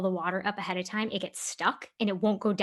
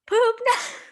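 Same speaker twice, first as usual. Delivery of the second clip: much higher, louder yell across the party; screaming; 14 semitones higher, 8 dB louder than usual. Retold or imitated. At a party, yelling for the family poop knife. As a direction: holler it like calling for help from the bathroom. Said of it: "he starts screaming".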